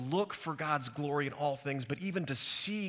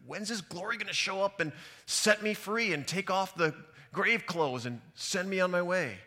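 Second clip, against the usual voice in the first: higher, louder voice of frustrated longing; impatient plea; 7 semitones higher, 5 dB louder than usual. Acted. Preacher voicing a believer tired of waiting, impatient plea. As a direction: plead it out, pitched up and emphatic.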